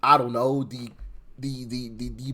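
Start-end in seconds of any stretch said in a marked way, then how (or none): none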